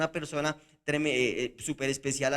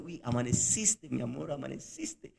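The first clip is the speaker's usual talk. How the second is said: soft, fond murmur, trailing off quieter; tender teasing; 2 semitones lower, 4 dB quieter than usual. Shown as a demonstration. Newlywed husband cooing to his wife, tender and soft. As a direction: coo it to her lovingly. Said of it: "le está diciendo lo contrario".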